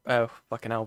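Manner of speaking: ordinary talk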